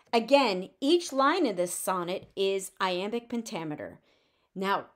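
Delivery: ordinary talk, nothing marked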